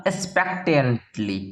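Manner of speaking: "expectantly" drawn out syllable by syllable, low; "expectantly" in slow, separated chunks; slow pronunciation demo. Shown as a demonstration. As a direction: sound out "expectantly" slowly for pupils to repeat. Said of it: "पढ़िए मेरे साथ एक बार".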